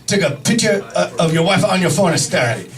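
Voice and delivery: put-on stop-and-start Japanese-accented doctor; mock Japanese accent, restarting phrases; imitated Japanese accent. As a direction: impersonate a Japanese doctor giving instructions. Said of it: "this is how he spoke".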